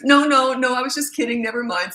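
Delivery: high, loud rushed denial fading out; panicked backpedal. Retold or imitated. Son hurriedly telling mom he was kidding, panicked. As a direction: scramble to take it back.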